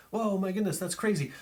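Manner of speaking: high, amazed whoa; mock amazed spectator. Spectator reacting to the vanishing ring, mock amazement. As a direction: play the stunned audience member, lightly. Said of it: "as they're kind of just".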